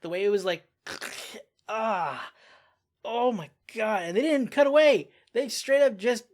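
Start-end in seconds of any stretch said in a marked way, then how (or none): 1.7-2.3 pained cry. Real, not acted. Gore scene on screen. 3.0-6.2 much higher and louder, shocked and disgusted; real stunned exclaiming; shocked outburst. Real, not acted. Reacting to brutal shot.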